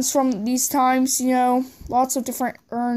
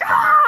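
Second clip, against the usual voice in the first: very high, loud play scream; high mock scream; 5 dB louder than usual. Vigilante figure enters the match, high mock scream. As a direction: shriek as a toy wrestler storms in. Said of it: "here comes the Vigilante, and he's all like".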